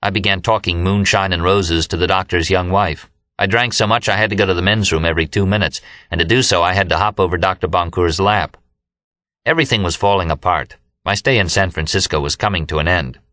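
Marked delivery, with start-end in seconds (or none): none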